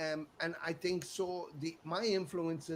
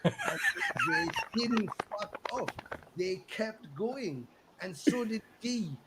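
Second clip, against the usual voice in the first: ordinary talk, nothing marked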